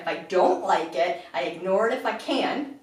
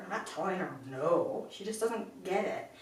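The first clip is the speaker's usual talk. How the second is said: usual speaking voice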